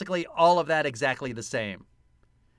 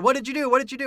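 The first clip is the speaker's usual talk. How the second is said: high, louder, rapid-fire repeated question; eager crowd questioning; 9 semitones higher, 5 dB louder, much faster than usual. Retold or imitated. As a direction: imitate an excited crowd demanding news.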